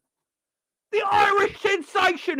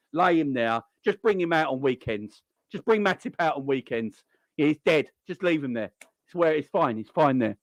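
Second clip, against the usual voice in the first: mock gaffer; 14 semitones lower than usual. Boss voice, burying Matip.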